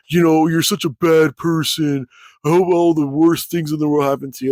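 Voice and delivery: lightly voiced nasty troll; mock voice of the online troll; mocking opponent. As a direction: sneer his curse back, mildly mocking. Opponent's insult voiced in retelling.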